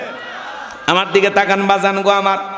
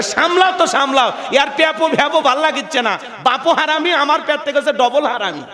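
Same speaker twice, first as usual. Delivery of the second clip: much higher, furious mock rant; angry wife; 6 semitones higher than usual. Fed-up wife shoves baby at husband, mock furious. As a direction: shriek it at the husband in a hammed-up rage. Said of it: "রাগান্বিত হয়ে বলে".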